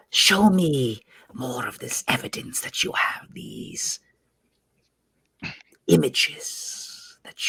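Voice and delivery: very slow, soft whisper; whispered snake villain, words drawn out; demanding serpent creature. Acted. Same serpent character demands evidence.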